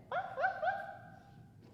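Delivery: high, slow airy woofs with a laugh; whispery puppy yips, laughing; breathy dog barks. Pretend. Teacher giggling through soft high barks. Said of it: "See, he likes it".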